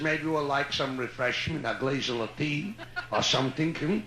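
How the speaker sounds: put-on low, courtly Yiddish-accented old gent; a lightly voiced Yiddish-accented suitor asking for a dance; Yiddish-accented old man. Old man asks a woman to dance, light Yiddish accent.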